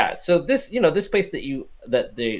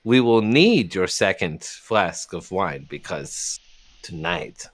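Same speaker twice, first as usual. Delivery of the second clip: solemn, slow; 7 semitones lower, slower than usual. Acted. Bartender's solemn announcement.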